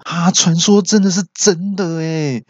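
high-pitched mock wonder; amazed realization. Friend finding the mirror legend true, amazed. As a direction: marvel at it, acted out. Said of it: "那朋友就想说".

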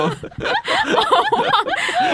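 words squeezed out through high laughter; laughing while talking. Real, not acted. Laughing at teasing. (边大笑边说)

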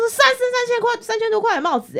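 shrill it out like an outraged stranger; retold angry boast. Stranger insisting his helmet cost three thousand, mock outrage.

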